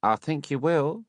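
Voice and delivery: a hint of a sly hostess; teasing Mrs Mann. A narrator's reading. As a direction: Mrs Mann insists the beadle will drink.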